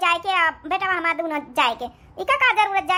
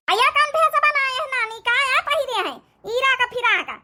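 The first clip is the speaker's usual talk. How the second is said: higher scornful taunt; mocking jibe; 5 semitones higher than usual. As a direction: sneer at her outfit. Mother-in-law mocks daughter-in-law.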